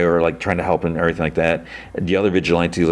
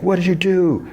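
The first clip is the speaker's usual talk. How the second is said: high, mock anguished cry; anguished demand; 13 semitones higher than usual. Keith going to his dad, anguished high demand. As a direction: imitate a distraught, accusing outburst.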